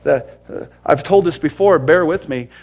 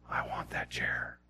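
hushed, slow whisper; whispered longing; 17 dB quieter than usual. Retold or imitated. First-time seeker in church voices a wish, whispered. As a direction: whisper it slowly, like a private thought.

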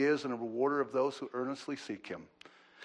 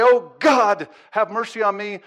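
loud cry for mercy, higher voice; pleading sinner; 5 semitones higher, 12 dB louder than usual. Retold or imitated. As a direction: call out to God, begging loudly. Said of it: "soften you up and say".

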